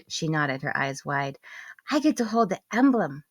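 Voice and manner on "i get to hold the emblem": put-on little girl voice. A narrator's reading.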